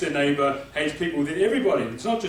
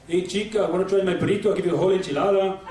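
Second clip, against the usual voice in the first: put-on flirty Mexican-accented pickup. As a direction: chat her up in a comic Mexican accent. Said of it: "with Mexicans or Latinos".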